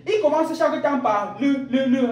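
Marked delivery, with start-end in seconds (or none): none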